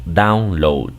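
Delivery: download with down and load each fully pronounced, run together; individual yet joined. Shown as a demonstration. Teacher shows learners prefix and word joined, download pronounced part by part. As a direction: say download as two whole words joined. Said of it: "como si fuera de manera individual".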